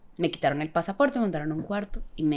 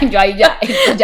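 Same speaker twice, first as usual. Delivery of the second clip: loud, higher laughing words; real laughter; 9 semitones higher, 12 dB louder than usual. Real, not acted. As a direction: laugh through the line. Laughing at the memory.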